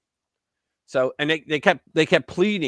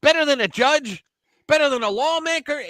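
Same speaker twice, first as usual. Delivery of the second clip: high, sneering rhetorical questions, a bit louder; mock their outraged disbelief, pitched way up; mocking incredulous quote; 11 semitones higher, 3 dB louder than usual. Critics scoffing at him, mock outrage pitched high. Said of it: "And they go".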